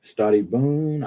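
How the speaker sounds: slow Italian Stati boon with long boon; light mock Italian goodbye; imitating Gianni. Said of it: "Gianni's always speaking Italian".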